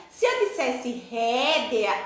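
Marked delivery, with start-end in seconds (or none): 1.1-2.0 "rédea" with a clear E before the A; spelling pronunciation. Shown as a demonstration. Teacher imagines the written form aloud, "rédea" with full E. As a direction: sound out "rédea" as ré-de-a. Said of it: "ia ter o sonzinho do E".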